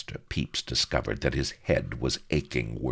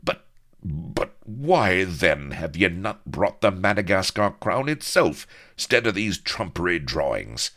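higher, louder, stumbling start; spluttering protest; 7 semitones higher, 6 dB louder than usual. A narrator's reading. Pepys challenging Vladimir over the drawings, spluttering. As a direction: fight for the words, then burst out. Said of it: "Master Pepys fought for speech".

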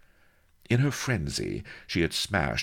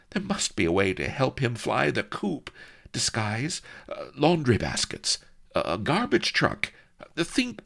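frantic princess; 7 semitones higher than usual. A narrator's reading. Princess scolding herself, high frantic outburst. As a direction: berate yourself into thinking.

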